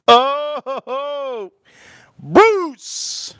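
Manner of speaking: high and loud, thrilled to see him; delighted greeting. Real, not acted. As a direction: greet Bruce with a happy shout. Delighted to see a super chat.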